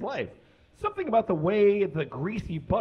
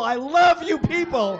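loud, higher, booming shout of love; shouted declaration; 12 semitones higher, 5 dB louder than usual. Acted. Comic bellowing love at the crowd, shouting. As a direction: yell it out to the whole room.

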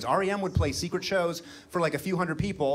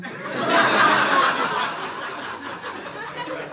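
high laughing wheeze; 4 dB louder than usual. Real, not acted. Laughs at the line he said.

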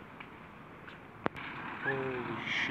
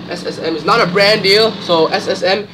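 much louder and higher, jokey yell at the brand; mock demanding shout; 6 semitones higher, 9 dB louder than usual. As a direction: bellow it at the company, half joking. Yelling at the brand for money.

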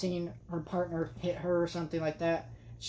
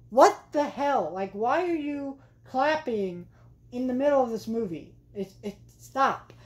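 exasperated rant; 6 semitones higher, 4 dB louder than usual. Real, not acted. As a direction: vent your irritation at them. Ranting about clappers.

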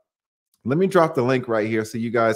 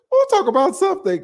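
put-on second try at the friend's accent, much higher; "We'll" lifted high in a put-on accent; Ike's accent again. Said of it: "I can't do a, his accent".

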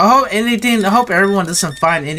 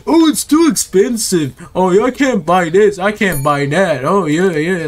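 mock complaint; 2 semitones higher than usual. Shopkeeper imitating customers on prices, mock complaint. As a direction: mimic a shopper moaning about cost. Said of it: "they're gonna say".

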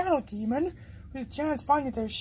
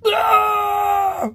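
loud, high scream of horror; startled scream; 7 semitones higher, 8 dB louder than usual. Acted. Seeing his new look, horrified scream.

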